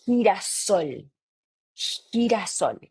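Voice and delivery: girasol with a throaty h-like G; Spanish girasol. Shown as a demonstration. Teacher modelling sunflower in Spanish. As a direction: rasp the G at the start of girasol. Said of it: "that one is gonna be like this".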